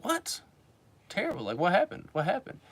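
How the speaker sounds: high, startled disbelief; mock surprise. Speaker to friend, high shocked disbelief. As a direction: jump up in pitch, baffled.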